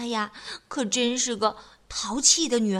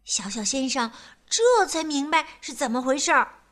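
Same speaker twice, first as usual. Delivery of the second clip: usual speaking voice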